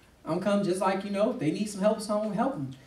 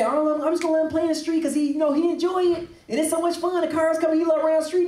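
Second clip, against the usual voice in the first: mocking careless parent; 7 semitones higher than usual. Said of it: "and you say".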